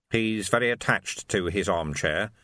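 ordinary delivery

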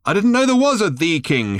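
high shout. A narrator's reading. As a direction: yell it, puzzled. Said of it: "shouted Ford".

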